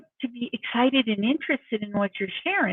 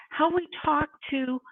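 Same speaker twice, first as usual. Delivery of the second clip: ordinary talk, nothing marked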